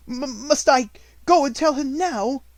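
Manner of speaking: flustered and reluctant; panting dismay. A narrator's reading. Miss Amelia to her fierce sister, slow breathless stammer. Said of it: "panted Miss Amelia".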